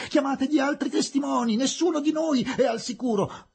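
very high, loud outcry; frantic shouting. A narrator's reading. Englishwoman demands other witnesses, shouting high. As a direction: cry out in alarm over the tumult. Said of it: "gridò missus Tarton nel tumulto crescente".

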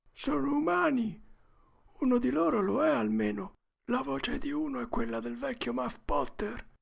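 put-on high, secretive child's voice; hushed boy Huck. A narrator's reading. Huck recognizes Muff Potter's voice, hushed. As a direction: report it high and under your breath. Huck reports what he hears.

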